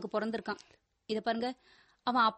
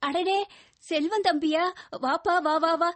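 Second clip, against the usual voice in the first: higher, louder surprised greeting; delighted welcome; 5 semitones higher, 4 dB louder than usual. Acted. Wife greets young visitor at home, delighted welcome. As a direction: welcome a guest in with eager excitement.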